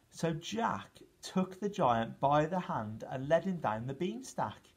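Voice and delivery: plain reading voice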